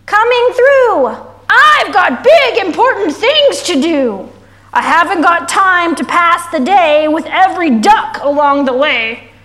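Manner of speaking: loud, self-important truck voice; yelling dump truck. A narrator's reading. Dump truck pushing past the animals, loud and bossy. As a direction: bellow slowly at first, hammering the big words. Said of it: "yelled the big dump truck".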